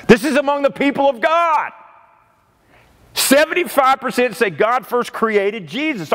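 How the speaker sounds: high, stunned re-reading of the figure; incredulous. Real, not acted. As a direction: say it again, voice up in shock. Repeating statistic in disbelief.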